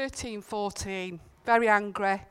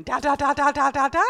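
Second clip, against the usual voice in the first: louder, higher mock dad coaxing baby; dad's baby talk; 4 semitones higher, 9 dB louder than usual. Dad coaxing his baby son to say dada, loud and eager. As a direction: call it out like a keen dad teaching his baby. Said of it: "And his dad going".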